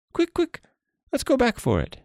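very high-pitched, hurrying creature voice; urgent jellyfish. Acted. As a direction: urge the monkey back in a squeaky voice. Jellyfish urging monkey to hurry back.

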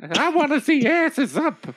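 put-on bossy old woman. Queen orders servants.

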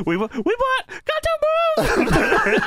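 squeaky high voice, breaking into laughter; mock high announcement voice; Tony Khan voice.

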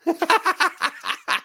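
high laugh that breaks out mid-story; can't hold back a laugh; real burst of laughter. Real, not acted. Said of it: "I'm sorry".

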